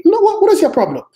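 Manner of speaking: loud, very high-pitched outburst; exasperated rebuke. Real, not acted. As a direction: cut them off in disbelief. Rebukes the imagined self-reliant believer.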